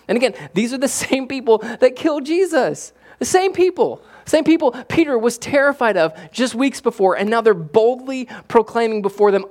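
high, loud, incredulous marvel; can you believe it, with a laugh; preacher marvelling at the disciples' boldness; amazed. Real, not acted.

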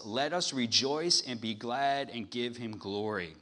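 loud, building preached reading; scripture reading. Acted. Preacher reading the hallelujahs to church, loud building read. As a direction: swell it like a roar of praise.

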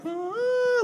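a wild high-pitched "Ah" of victory; mock-heroic cry of triumph; crazy triumphant cry. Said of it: "like, crazy".

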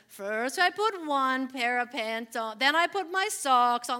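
loud, slow, mock-earnest step-by-step recital; silly routine recital. Mom retelling the family's silly routine, loud and slow. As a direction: ham up the routine like a game. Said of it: "it got ridiculous".

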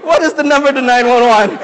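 high, loud, laughing panic; flustered bystander laughing, unsure how to call for help; nervous laughing. Retold or imitated. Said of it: "I was so nervous".

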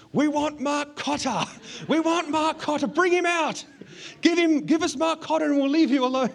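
very high, loud mock chant of an angry gang; crazed mob yell. Teenage gang outside the house demanding him, imitated crazed yelling. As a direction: yell it high like a baying crowd. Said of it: "they go crazy".

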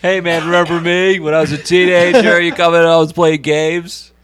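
loud, goofy voice of a pushy cafe regular; loud mock impression. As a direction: ham up the annoying guy loudly. Mocking the gaming cafe guy.

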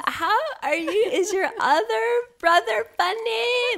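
high, loud, squealing tipsy voice; drunk squealing fan. Pretend. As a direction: play the drunk heckler, high and giddy. Plays along as drunk fan.

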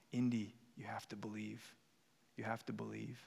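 hushed, whispered mumble; very soft, breath-only murmur of an injured man; mumbling to himself. Acted. Injured father to his son Indy, mumbling to himself. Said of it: "he keeps mumbling to himself".

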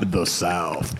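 a mock, overdone Southern American drawl, slow; lightly hammed Southern American accent; fake Southern accent. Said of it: "His weird fake Southern accent that he was doing".

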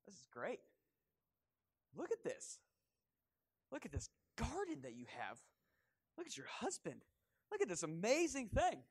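a hint of a coaxing serpent, rising in pitch; sly serpent. Preacher voicing the serpent tempting Eve, lightly coaxing. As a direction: flatter Eve, lightly voiced. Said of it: "and he goes".

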